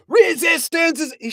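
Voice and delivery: high barking alien bellow; Vogon guard bellow. A narrator's reading. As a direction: bellow it with full lungs.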